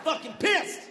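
high, hammed-up outraged yelling; pissed off. Driver stewing after being called ugly, high angry rant. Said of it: "Now I'm pissed".

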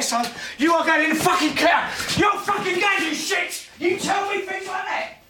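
high, full-throated furious tirade; out-of-control yelling, pitched right up; enraged shouting. Acted. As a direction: scream it at your son in a rage. Father violently rages at his son.

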